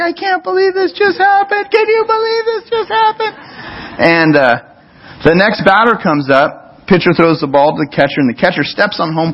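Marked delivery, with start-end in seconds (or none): 0.0-3.4 high, thrilled disbelief; amazed crowd. Retold or imitated. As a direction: squeal it like stunned parents in the stands. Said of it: "We're like".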